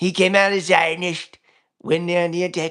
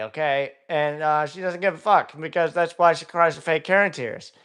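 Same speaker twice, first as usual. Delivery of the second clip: ordinary talk, nothing marked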